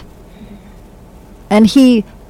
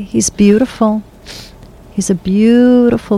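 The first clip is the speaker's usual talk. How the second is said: ordinary talk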